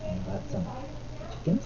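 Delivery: soft, hushed bedtime-story voice; quiet picture-book reading to a little one. Real, not acted. Reads picture book to toddler.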